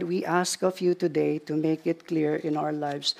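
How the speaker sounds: ordinary talk, nothing marked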